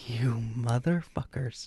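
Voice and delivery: slow, hushed cursing; retold resentment. Retold reaction to fake sponsor email.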